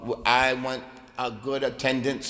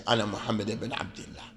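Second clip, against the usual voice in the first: "Ana Muhammad ibn Abdullah" whispered from memory; whispered recitation; 4 semitones lower, 6 dB quieter, faster than usual. Shown as a demonstration. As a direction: murmur it without looking. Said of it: "take your eye off the page".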